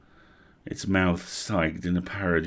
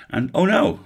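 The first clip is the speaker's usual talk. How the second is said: sudden alarm; 10 semitones higher, 7 dB louder than usual. Acted. Elf spots the bites.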